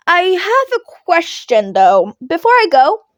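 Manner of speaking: put-on a bright-eyed kid with a question; lightly voiced Midoriya. Young hero asks his teacher before leaving, eager.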